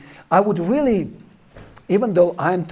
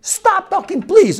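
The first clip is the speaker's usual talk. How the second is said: high, loud irritated snap; fed-up coworker scolding Peter; annoyed scolding; 13 semitones higher, 6 dB louder, slower than usual. Retold or imitated. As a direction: tell him off for saying please.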